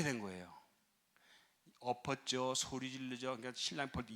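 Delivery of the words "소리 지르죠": usual speaking voice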